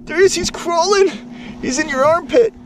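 high, loud fake panic; mock alarm. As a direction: tease with pretend alarm. Dad teases with fake alarm.

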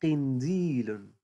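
قنديل drawn out slowly with the ق held; teacher slowly modelling قنديل; slow stretched word. Shown as a demonstration. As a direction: stretch قنديل so a child can hear the ق. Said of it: "هذا يسمى".

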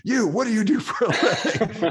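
high, loud, breaking into laughter; laughing mock interrogation. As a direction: bark the question, cracking up. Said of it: "It was like, it, it was completely insane".

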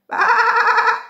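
high, loud bleat; bleating sheep. Acted. Sheep's call in story.

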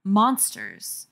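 ordinary delivery

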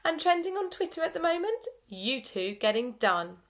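high sniggering gloat, slowing at the end; smug tell-tale sniggering; evil snigger. A narrator's reading. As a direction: relish getting them in trouble. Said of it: "with an evil snigger".

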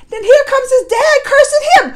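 high, loud, laughing disbelief; incredulous. Real, not acted. Vlogger recounting a father cursing his son, high-pitched and incredulous. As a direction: exclaim it as if scandalized, pitch way up.

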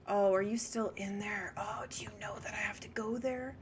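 soft, unvoiced whisper. Real, not acted. Streamer asking lurking player if still there, whispering. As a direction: whisper warily, as if you might be overheard.

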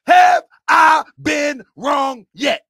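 slow, high shout, word by word; loud, drawn-out challenge; yelling. Real, not acted. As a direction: hammer each word out at full volume. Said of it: "am I yelling too much?".

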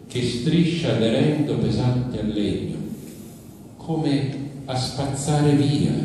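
dramatic literary narration, phrase by phrase; chorus commentary. Acted. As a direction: narrate like a tragic chorus, pausing between phrases. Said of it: "io invece sono una voce come del coro che commenta". (voce del coro, narrazione drammatica scandita)

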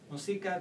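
"Música" mis-stressed as mu-SI-ca; second-last syllable stress. Shown as a demonstration. Teacher showing what does not sound Spanish, middle-syllable stress. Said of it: "does not sound Spanish".

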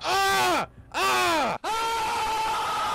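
high, drawn-out imitation screams; repeated long wailing "ah"; mock screaming. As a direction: mimic someone screaming every second. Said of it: "Every second he's like".

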